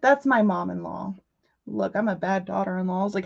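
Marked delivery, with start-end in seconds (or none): none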